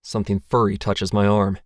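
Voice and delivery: ordinary delivery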